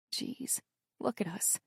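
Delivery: whispered, half amused; rueful whisper. Acted. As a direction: breathe it out under tears. Teary rueful aside.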